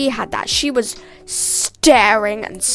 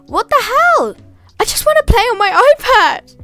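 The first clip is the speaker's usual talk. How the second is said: high, louder whiny protest; exasperated outburst; 10 semitones higher, 6 dB louder than usual. Retold or imitated. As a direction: complain like a thwarted child. Venting frustration about lost iPad time.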